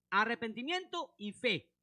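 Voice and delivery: slow, weighty "Arrepentimiento y fe"; slow emphatic. Real, not acted. Preacher hammering the gospel's elements, slow stress. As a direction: lay down each word slowly. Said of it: "son arrepentimiento y fe".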